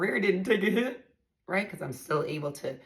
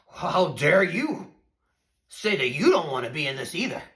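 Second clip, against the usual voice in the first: imitating outraged husband; 5 dB louder than usual. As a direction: drag it out like the offended husband. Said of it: "And then it was like".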